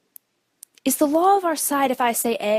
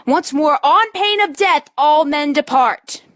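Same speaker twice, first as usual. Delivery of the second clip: loud, slow last order; stern final command; 2 semitones higher, 4 dB louder than usual. Acted. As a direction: lay down the law one last time.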